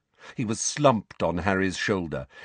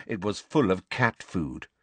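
ordinary delivery

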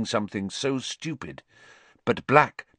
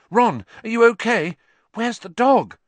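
alarmed cry; 11 semitones higher, 7 dB louder than usual. Acted. Hermione finds Ron hurt.

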